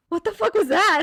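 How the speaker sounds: high, loud incredulous demand; imitated outrage. Friend confronting her in the car after the prank, imitated outrage. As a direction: act out an indignant friend demanding answers.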